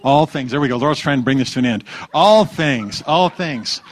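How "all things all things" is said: emphatic echo. Acted. Echoing congregation's answer.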